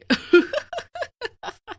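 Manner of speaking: high, drawn-out villain laugh; gloating witch cackle. Acted. Witch watches customers eat her burgers, high gloating cackle. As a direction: cackle high with wicked glee.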